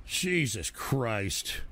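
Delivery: slow, low exasperated groan. Real, not acted. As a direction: drag it out in despair. Exasperated sigh.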